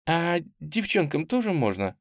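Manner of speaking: lightly voiced high little girl; small hoarse voice. A narrator's reading. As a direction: ask in a hint of a child's voice. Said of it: "спросил из мрака трубы какой-то хриплый голосок".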